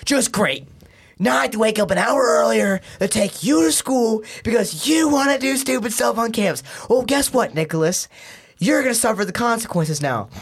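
high, sarcastic scolding mom voice; imitating scolding mom. Mom scolding her son about driving him, high and sarcastic. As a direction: rub it in like an annoyed mom.

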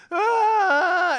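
loud, high hammed-up anguished cry; a dramatic sob of despair; over-the-top comic wail; mock wail.

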